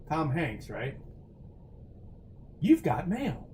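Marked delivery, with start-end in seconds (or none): 2.6-3.5 higher, put-on announcement of the catchphrase; mock catchphrase. Streamer quoting a film title to chat, mock catchphrase. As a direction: play it as a lightly hammed announcement.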